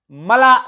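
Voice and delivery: "मला" as one word, ma-laa, for M-L-A; wrongly blended word. Shown as a demonstration. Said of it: "नहीं कह सकते". ("मला" एक शब्द की तरह, अक्षर अलग नहीं)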